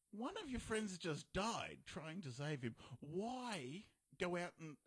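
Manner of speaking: slow, high exasperation; exasperated. Real, not acted. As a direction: spell out how stupid it is. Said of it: "exasperated rant".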